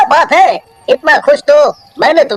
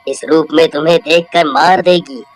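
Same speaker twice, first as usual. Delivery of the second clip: deep, lower holy man's voice; old sage; 6 semitones lower than usual. Acted. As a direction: wise old baba consoling the witch. Sage speaks to witch. (गहरी आवाज़ में बूढ़े साधु बाबा)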